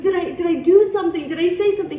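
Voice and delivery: high, loud, urgent questions; retold insistent questioning. Youth worker begging director to explain, insistent. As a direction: re-enact pressing hard for a reason.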